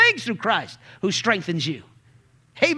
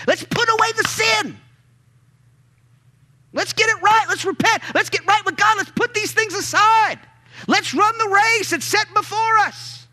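preaching at full force; 8 semitones higher than usual. Retold or imitated. Paul preaching to the church, rousing full-force sermon call. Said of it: "He's preaching".

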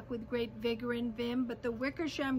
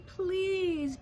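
higher pleading plea; 6 semitones higher than usual. A narrator's reading. Mayor begging the elephant for help, pleading. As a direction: beg a favor, a touch imploring.

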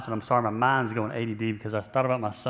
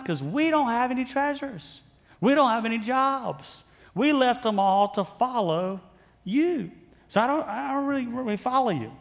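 higher, pleading disciple voice; bewildered disciples; 5 semitones higher than usual. Retold or imitated. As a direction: protest like a confused fisherman to Jesus.

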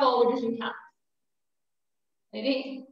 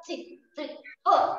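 normal talking voice

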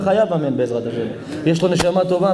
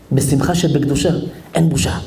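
usual speaking voice

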